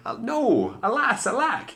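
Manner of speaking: high, loud hammed-up wail; cried. A narrator's reading. The prince cries out as Cindy flees. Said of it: "The prince cried".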